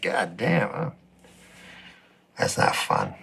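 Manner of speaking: low, dropped-off grumble of disgust; grumpy morning. Retold or imitated. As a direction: sag into a defeated grumble. Sour waking quote, low, whispery.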